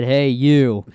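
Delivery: loud hail at normal pitch; retold yell. Storyteller repeats what the biker said, loud retold yell. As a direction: imitate a biker's loud call, pitch kept down. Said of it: "He yelled at me".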